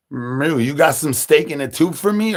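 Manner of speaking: usual speaking voice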